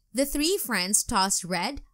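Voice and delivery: plain reading voice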